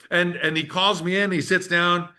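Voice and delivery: ordinary delivery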